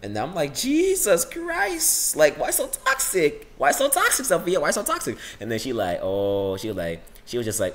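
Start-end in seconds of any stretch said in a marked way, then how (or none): 0.5-5.1 playfully scandalized, voice pitched way up; mock outrage. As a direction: squeal in exaggerated dismay. Retold mock outrage at rival.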